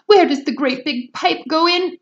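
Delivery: high loud shriek. Acted. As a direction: shriek it frantically. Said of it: "shrieked Missus Salt".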